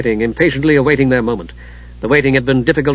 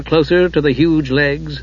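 ordinary delivery, nothing marked

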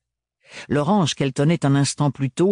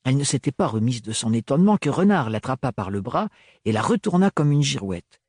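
ordinary delivery, nothing marked

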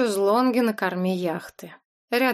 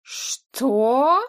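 higher, louder startled what; surprised exclamation; 4 semitones higher, 4 dB louder than usual. A narrator's reading. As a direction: burst out in surprise. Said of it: "удивлённо воскликнул Саид".

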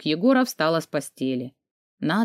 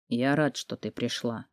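low, very soft murmur; whispered confession; 6 semitones lower, 8 dB quieter than usual. A narrator's reading. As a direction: drop right down, close and intimate. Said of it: "прошептал он мне в волосы". (тихо, почти шёпотом на ухо)

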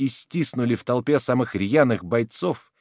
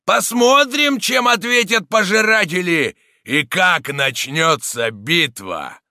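loud rallying cry, dropping at the end; booming shout; 8 semitones higher, 5 dB louder, slower than usual. A narrator's reading. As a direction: shout it out over the crowd. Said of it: "громко раздался зычный голос".

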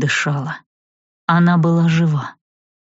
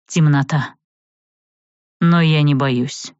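usual speaking voice

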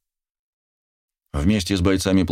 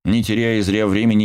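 plain reading voice

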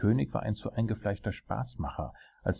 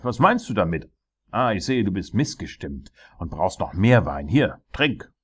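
furious; 3 semitones higher, 8 dB louder than usual. A narrator's reading. Tyrant forcing more wine on dwarf, furious. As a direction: rage at him like a tyrant. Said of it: "schrie der Tyrann wütend".

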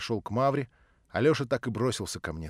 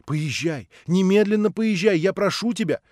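loud, higher-pitched urging; urgent insistence; 6 semitones higher, 5 dB louder than usual. A narrator's reading. Natasha sending Alyosha to his father, urgently insistent. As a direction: press him to go at once.

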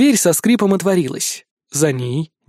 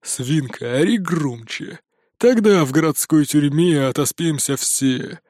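angry grumble. A narrator's reading. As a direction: grumble it out with spite. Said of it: "зло проворчал Серый".

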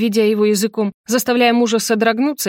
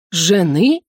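растянутый вопрос ошарашенного отца; переспроси, не веря ушам; поперхнувшись от изумления; 4 dB louder than usual. Acted. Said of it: "поперхнулся воздухом отец". (ошарашенно)

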